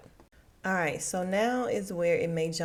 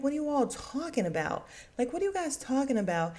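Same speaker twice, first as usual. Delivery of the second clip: higher, mildly indignant retort; irritated bafflement; 7 semitones higher, faster than usual. Retold or imitated. Imitating husband questioned by the women, mildly irritated. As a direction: push back as if blindsided. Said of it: "he just gets irritated".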